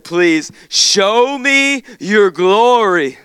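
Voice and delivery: slow, high and loud plea; slow impassioned cry; pleading plea. Acted. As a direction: stretch it out, begging. Moses' plea read out.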